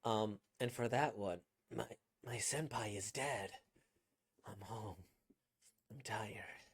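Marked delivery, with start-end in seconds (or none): 1.8-6.6 very soft, drained and broken; mind break. Shown as a demonstration. Voice actor performing the mind-broken character coming home, drained and soft. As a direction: let the voice sink away to almost nothing. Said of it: "getting a mind break type thing".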